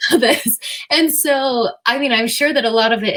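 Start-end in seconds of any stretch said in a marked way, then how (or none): 0.1-0.6 put-on higher, frazzled plea to a child; overwhelmed mother sending her kid to her room; exasperated mom. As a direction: sound worn out and at your limit. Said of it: "would make me be like".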